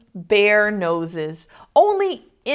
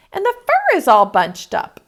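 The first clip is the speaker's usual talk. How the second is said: higher, a touch of a huff; huffy mama bear; 10 semitones higher, 4 dB louder than usual. Acted. Mama Bear checking her fancy boots, higher huff. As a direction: huff it out like an annoyed mother. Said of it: "she huffed".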